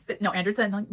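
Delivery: ordinary talk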